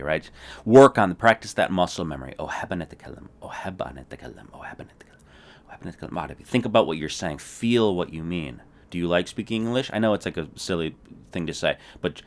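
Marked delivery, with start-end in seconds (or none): 2.4-6.5 fading unvoiced whisper. Shown as a demonstration. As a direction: whisper the drill, trailing off. Whispered practice drill.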